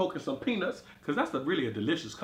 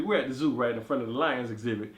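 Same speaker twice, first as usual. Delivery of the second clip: ordinary talk, nothing marked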